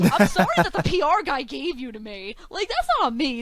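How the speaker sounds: loud, high retold protest; laughing mock defence. Interviewer blaming the PR rep, playful loud protest. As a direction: protest loudly through a laugh, pitch climbing.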